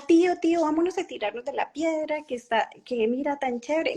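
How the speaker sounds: put-on high, eager calling of a small boy; excited child calling. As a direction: call out to the uncle like an excited kid. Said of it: "él de la, a la carrera".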